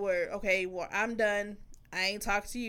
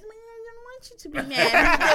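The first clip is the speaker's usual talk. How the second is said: much higher, louder, pleading; sucking up; 11 semitones higher, 7 dB louder than usual. Retold or imitated. Sucking up to her mad partner, high pleading. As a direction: coax him sweetly. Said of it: "I just".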